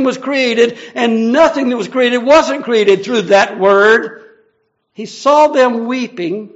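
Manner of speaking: loud, proclaiming sermon voice, slowing to weigh each phrase; full-force preaching. Acted. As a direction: thunder out who this Word is.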